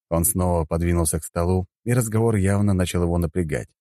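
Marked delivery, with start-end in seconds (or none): none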